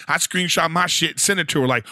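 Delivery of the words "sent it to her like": ordinary talk, nothing marked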